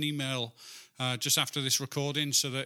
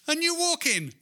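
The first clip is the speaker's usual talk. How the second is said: rising high and loud; excited retelling; 14 semitones higher, 5 dB louder than usual. Retold or imitated. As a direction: build to a high, excited peak. Building excited retold scene.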